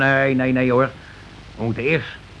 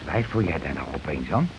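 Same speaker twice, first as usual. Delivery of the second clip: low suspicious question; 8 semitones lower, 7 dB quieter than usual. Acted. Husband suddenly suspicious of wife.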